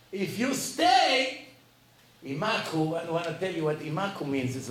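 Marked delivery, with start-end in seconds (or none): 0.1-1.4 very slow, high, louder, each word weighted; slow warning. Acted. Rabbi warns the student about the verse, slow and heavy. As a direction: drag it out as a grave warning.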